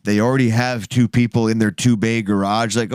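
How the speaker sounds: ordinary delivery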